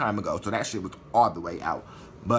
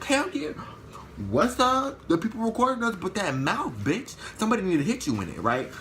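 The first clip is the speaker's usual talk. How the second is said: hyped taunt; 10 semitones higher than usual. Retold or imitated. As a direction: get amped and mock her right back. Hyped taunt back at opponent.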